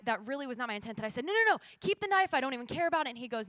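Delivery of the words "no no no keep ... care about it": high, loud, frantic protest; acted panic, push it away loudly; nervous refusal. Nervous traveler refusing her knife back, retold frantic protest. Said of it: "I was so nervous".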